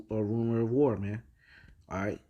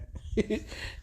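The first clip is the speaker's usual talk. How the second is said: breathy laugh; 11 semitones higher, 5 dB quieter than usual. Real, not acted. Laughing at his own joke.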